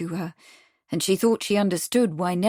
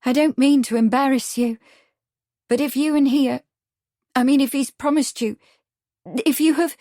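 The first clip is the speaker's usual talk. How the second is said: close to tears; 6 semitones higher, 5 dB louder than usual. A narrator's reading. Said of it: "her eyes suddenly brimming".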